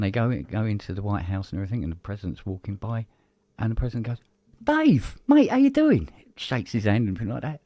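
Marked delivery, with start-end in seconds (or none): 4.6-6.1 much higher, louder matey greeting; cheery celebrity greeting. Retold or imitated. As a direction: greet an old mate with delight. President greeting Dave.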